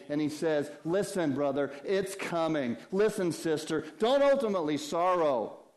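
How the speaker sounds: impassioned sermon voice; fervent preaching. Acted. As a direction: urge them like family. Preaching at full force.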